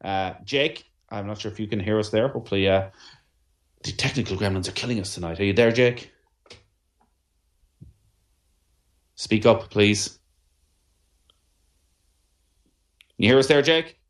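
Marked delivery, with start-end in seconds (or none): 5.4-14.0 loud, clear call to a bad line; to someone hard of hearing. Real, not acted. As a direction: raise your voice for the caller. Calling out to a caller who can't hear.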